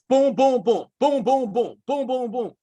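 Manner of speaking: high, loud rhythmic booms; rapid-fire imitation of drilled blows; punchy strike sounds. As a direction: punch out each boom like a sparring combo. Imitates repeated martial arts drill strikes.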